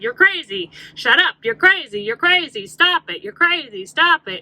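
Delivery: loud, scornful mock shouting down; imitating people yelling you're crazy, stop it; mock dismissive yelling. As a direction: shout her down, mocking and impatient. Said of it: "everyone's like".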